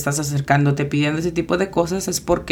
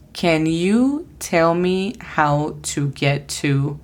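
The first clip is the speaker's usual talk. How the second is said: each word of "Can you tell me how to get to?" said slowly and clearly; slow careful model; much slower than usual. Shown as a demonstration. Teacher repeating the phrase slowly after spelling it out. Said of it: "la pronunciación fonética es".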